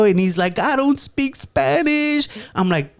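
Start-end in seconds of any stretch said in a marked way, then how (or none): 0.6-2.3 high, slow mock whine; mimicking a whiner. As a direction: imitate a wailing kid, stretched and pitched way up. Uncle mimics the frightened boy.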